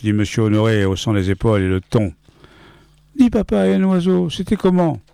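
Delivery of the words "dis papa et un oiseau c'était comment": put-on high, wide-eyed child asking father; little boy voice. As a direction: play the small son, much higher. Son asks about birds.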